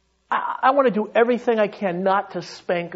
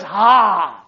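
triumphant shout; 6 dB louder than usual. Acted. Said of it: "He looks at you and says".

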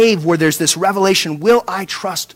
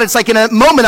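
ordinary talk

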